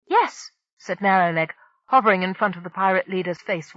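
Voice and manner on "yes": high creature voice. Acted. Small Criddy answers.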